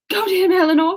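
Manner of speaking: high-pitched, desperate urging; urgent cry. A narrator's reading. Marianne begging her sister to fetch him, urgent. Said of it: "she cried".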